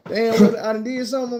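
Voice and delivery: high alarmed burst; startled. Retold or imitated. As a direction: jump like you're in trouble. Godmom alarmed.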